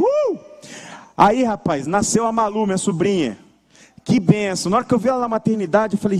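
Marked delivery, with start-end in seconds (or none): 0.0-0.4 high-pitched playful whoop; excited whoop. Retold or imitated. Recalling his dream of three children, high mock-eager whoop.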